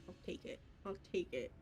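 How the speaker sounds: soft whispered resignation; whispering. Real, not acted. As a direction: whisper it to yourself.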